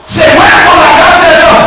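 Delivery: high shouted proclamation; impassioned preaching. Real, not acted. Preacher driving the point home to the church, impassioned shout. Same line repeated at full force.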